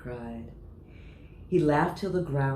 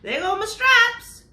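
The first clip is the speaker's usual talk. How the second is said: very high, loud, slow yell of dismay; zebra yelling; 13 dB louder, slower than usual. Acted. Zebra yelling as his stripes fly off. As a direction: wail about the lost stripes. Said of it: "The zebra yelled".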